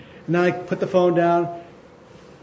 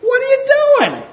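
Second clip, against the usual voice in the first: high, loud disbelieving cry; exasperated cry; 4 dB louder than usual. Retold or imitated. Paul scolding the people, exasperated high cry. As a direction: throw up your hands, shrill with disbelief. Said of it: "he's telling the people".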